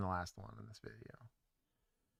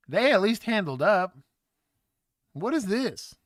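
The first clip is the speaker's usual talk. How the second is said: much louder and higher, a real burst of disbelief; excited outburst; 9 semitones higher, 11 dB louder than usual. Real, not acted. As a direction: blurt it out at the screen, louder and higher. Streamer reacting loudly to footage.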